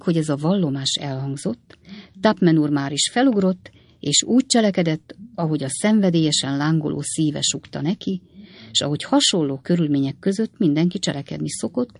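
plain reading voice

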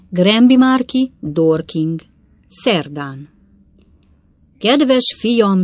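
put-on old coachman dictating his woeful news; father's rambling letter. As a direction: read out a clumsy letter, clause tumbling after clause. Reads father's letter aloud in story.

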